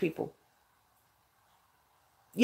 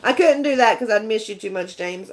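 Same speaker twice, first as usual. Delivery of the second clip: usual speaking voice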